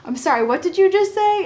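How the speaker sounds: high, loud disbelieving question; re-enacted stunned reaction; incredulous. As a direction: demand a repeat in amused shock. Acted shocked reply to sonographer's news.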